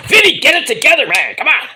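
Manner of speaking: loud, high teasing call. Real, not acted. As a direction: shout at him to get it together. Calling out to Vinny.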